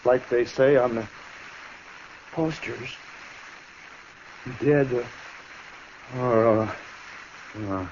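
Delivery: broken phrases with long gaps; dying, fading. Acted. Shot outlaw quotes his poster to the marshal, dying and fading. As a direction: let the words give out as life fades.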